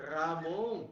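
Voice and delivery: Ramon said loud and stressed; Ramon, the other sound; contrasting Ramon. Shown as a demonstration. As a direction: hit Ramon hard for contrast. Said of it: "Não confundir com".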